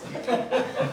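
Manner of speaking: quick airy chuckle; a breathy little laugh; soft laugh. Real, not acted. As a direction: laugh lightly at the joke. Laugh at the angels joke.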